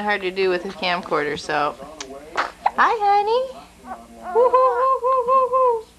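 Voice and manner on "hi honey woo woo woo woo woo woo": high, louder cooing woos. Real, not acted. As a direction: hoot high and bright to distract her. Soothing baby with coos.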